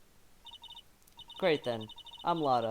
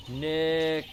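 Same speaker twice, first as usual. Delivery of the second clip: loud call; 5 dB louder than usual. Shown as a demonstration. Calling into the camera mic.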